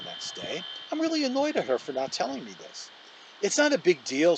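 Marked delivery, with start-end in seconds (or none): 3.4-4.4 defensive, a bit louder. A narrator's reading. Olivia brushes off Justin's complaint, defensive. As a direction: bristle and push the question away. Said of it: "she answers defensively".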